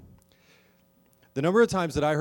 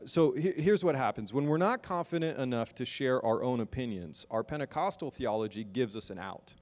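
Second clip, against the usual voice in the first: usual speaking voice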